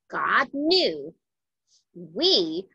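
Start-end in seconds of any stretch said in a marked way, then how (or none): none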